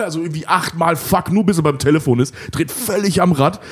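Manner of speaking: ordinary talk, nothing marked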